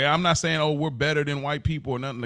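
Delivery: ordinary delivery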